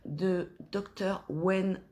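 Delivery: put-on English name said with a French accent; slow, careful English name in a French accent; a French speaker attempting an English accent. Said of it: "mon accent anglais est des fois un petit peu français".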